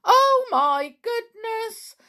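high, loud pixie dismay; tiny pixie's agitated cry. A narrator's reading. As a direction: exclaim in high-pitched alarm. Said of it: "cried the biggest pixie".

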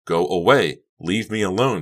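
loud, restrained narrated scream; angry scream. A narrator's reading. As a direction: lash out at her loudly. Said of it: "Becca screamed".

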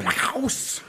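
mock German accent. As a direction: put on a German voice. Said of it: "I gotta get louder if I wanna do German".

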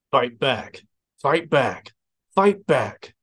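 acted interrogator's escalating order; goading command. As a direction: goad harder with each repeat. Interrogator urging prisoner to fight.

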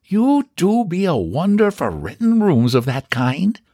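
put-on high Irish-accented neighbour; a nosy Irish neighbour, impressed; Irish gossip voice. Mrs McCool speaks.

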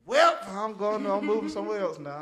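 exaggerated loud announcement; loud exclaim. Exaggerated reaction to gas prices.

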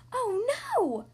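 high, loud cry of alarm. A narrator's reading. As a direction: gasp it out in sudden panic. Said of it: "cries Vee".

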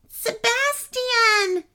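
high, long drawn-out call; shouted name. A narrator's reading. Kenny to sloth during the car chase, shouting. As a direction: stretch the name into a cry. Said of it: "shouted Kenny".

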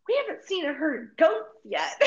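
high, thrilled exclamation; delighted exclaim. Retold or imitated. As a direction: gush about the goats. Delighted at seeing goats.

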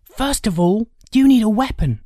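put-on high, loud boy's voice; urgent older brother. Brother arming his younger brother for a fight, urgent. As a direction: take charge, high and loud.

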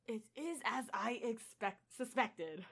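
soft, lightly Australian Thor; a hint of an Australian accent. Reader voicing Thor in a faint Australian accent. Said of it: "still attempting it".

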